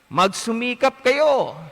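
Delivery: scripture quote. Acted. Priest quoting the Lord's gospel to the congregation, solemn. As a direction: proclaim the Lord's words with weight. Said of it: "sabi nga eh".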